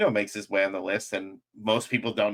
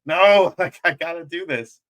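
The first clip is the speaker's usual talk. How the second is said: loud, higher-pitched retold refusal; loud, pleading insistence; insistent protest; 7 semitones higher, 6 dB louder than usual. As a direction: burst out on the no, digging in. Acting out refusal to give up.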